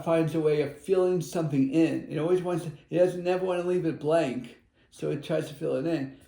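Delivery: usual speaking voice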